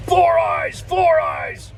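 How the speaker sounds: high taunting shout of four eyes; mocking yell. DOT officer taunting the trooper across the room, mocking yell. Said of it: "this guy's yelling over to the state trooper".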